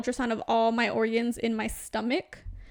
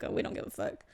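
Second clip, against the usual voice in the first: whispered dog voice; 10 dB quieter, faster than usual. Retold or imitated. Dogs voiced in story.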